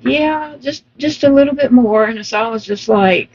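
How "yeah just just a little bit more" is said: lightly voiced nurse, higher pitched; imitated nurse. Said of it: "And she said".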